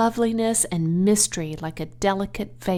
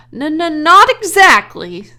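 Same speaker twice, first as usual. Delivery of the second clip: higher, louder stuttering reply; shaken stammer; 8 semitones higher, 13 dB louder than usual. Acted. Lurvy answers his boss, stammering and shaken. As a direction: stumble over it, rattled.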